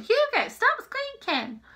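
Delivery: high, drawn-out scolding of the dog; mock-exasperated high call; exasperated self-quote. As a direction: mimic yourself nagging a pet, pitched high and slow. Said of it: "and I'm just going".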